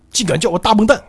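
high-pitched villain bluster; indignant. Acted. Villain resents being called big idiot, indignant. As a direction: protest hotly, climbing to a high squawk.

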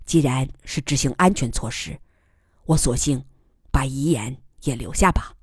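calm. Acted. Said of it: "平静地说".

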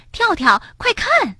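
high, excited call to a friend. A narrator's reading. Rabbit calls Frog to look at the sky, excited. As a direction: call out eagerly, pitch leaping up. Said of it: "兴奋地喊道".

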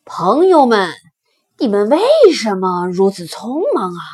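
big animal calling out loudly; loud elephant question. Acted. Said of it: "大象大声地问". (大象大声询问)

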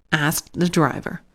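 ordinary delivery

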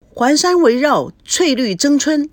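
usual speaking voice

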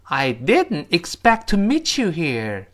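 slow, clear sentence for repeating; slow model reading. Shown as a demonstration. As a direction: read each word clearly and slowly. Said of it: "跟老师一起念". (慢速清晰跟读示范)

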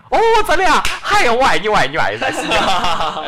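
high, loud, overjoyed squeal of love; excited gushing. Acted. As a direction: gush like she's just struck gold. Girl gushing over US hukou.

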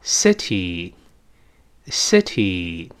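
"city" very slowly, eh-like first vowel, final ee; drawn-out model "city" with crisp T; correct vowel demo. Shown as a demonstration. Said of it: "所以读成".

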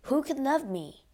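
mild growl. A narrator's reading. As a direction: growl it lightly in self-pity. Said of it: "he growled".

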